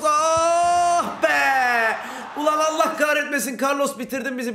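commentary climbing into a high, drawn-out yell; anguished shouting. Real, not acted. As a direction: cry out in frustration as the goal goes in. Opponent scores against the player. (yenilen gole isyanla bağırış)

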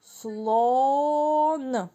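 Слон drawn out so the О is heard; sounding out. Shown as a demonstration. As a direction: stretch the vowel in Слон for the class. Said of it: "Після Л який ми звук чуємо". (протяжно, виділяючи звук О)

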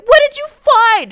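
high, loud alarmed question; alarmed demand. Pretend. Arthur demands what Charlie found.

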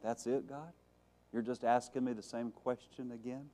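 very soft, puzzled questioning; acted, muted incredulity; quiet disbelief. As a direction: question God under your breath. Imagined Elijah questioning God quietly.

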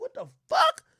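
high, loud and stunned; a disbelieving yelp at the screen; shocked what. Retold or imitated. To his girlfriend's show, a stunned high what the fuck. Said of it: "I'm like".

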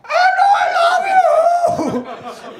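loud, high honking wail; hammed-up animal squeal of devotion; drunken sea lion. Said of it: "like a drunken sea lion".

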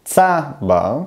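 צבר slowly split, weight on opening TSA; stress on first syllable. Shown as a demonstration. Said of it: "אני מדגיש את ההברה הראשונה".

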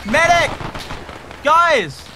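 loud, high shouts for a medic; man down shout. Real, not acted. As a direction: yell it across the battlefield. Teammate down, calling out.

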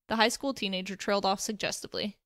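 ordinary delivery

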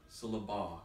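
syllaba said as syl-la-BAH; syllaba, landing on a final BAH; stress on last syllable. Shown as a demonstration. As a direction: move the accent to the end of syllaba. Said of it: "I moved the accent from each".

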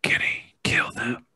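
eerie whispered urging. Pretend. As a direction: whisper it close to the mic. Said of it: "When you whisper?".

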